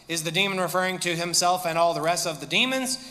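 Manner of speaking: normal talking voice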